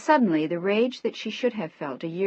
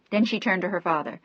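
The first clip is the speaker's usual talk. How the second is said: plain reading voice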